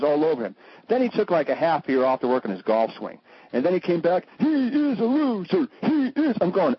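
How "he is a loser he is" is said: high, slow, sneering media voice; mock the press trashing him. Mocking the press turning.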